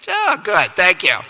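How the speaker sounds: high, sarcastic little thank-you; mock gratitude. Runner answering coach's pressure before the baton, mock gratitude.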